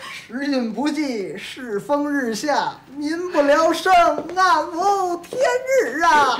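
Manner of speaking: slow, high-pitched wailing declamation; theatrical lament. Acted. Victim of his friends' body search bemoaning fate, theatrical lament. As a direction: draw out each phrase, building to a wail.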